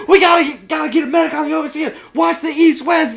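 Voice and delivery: high, loud, strained battlefield shouting; hammed-up urgent military commands; military captain. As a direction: imitate an over-serious captain yelling orders. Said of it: "he is the captain of the group".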